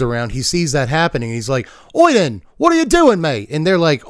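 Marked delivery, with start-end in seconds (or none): 1.9-3.5 high, loud mock-British shout; hammed-up Monty Python style British; comic British lad calling out in indignation; British accent. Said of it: "doing the British thing".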